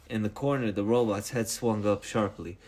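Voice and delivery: ordinary delivery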